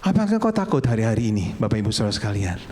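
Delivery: ordinary talk, nothing marked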